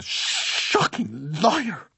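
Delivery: high, drawn-out accusing cry; outraged cry. A narrator's reading. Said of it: "Missus Doolin cried".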